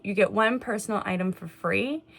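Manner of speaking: ordinary talk, nothing marked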